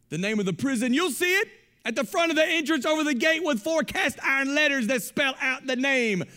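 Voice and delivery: high, loud preacher's shout, slow and driving; full-force preaching. Acted. Pastor warning the church about the prison of want, shouted preaching. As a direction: preach it hard to the congregation, hammering every line.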